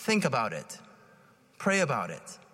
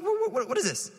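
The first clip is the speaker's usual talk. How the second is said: mock baffled outrage. Baffled at pastor in room.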